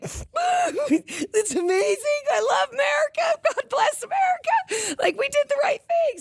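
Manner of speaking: high, tearful shouted sobbing; mock crying. Vlogger retelling her teary reaction to the show. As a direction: wail it, overcome with patriotic tears. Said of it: "here I am like crying".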